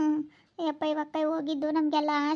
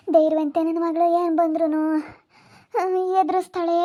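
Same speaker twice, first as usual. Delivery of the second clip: louder, crying out in grief; loud lament; 7 dB louder than usual. Real, not acted. Mother cries out that her brave daughter would face anything. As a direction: declare it through the pain.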